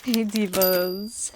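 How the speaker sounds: drawn-out, lilting hey divas; slow lilting hello; slow greeting. Acted. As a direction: greet the viewers long and sing-song. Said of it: "vlog greeting".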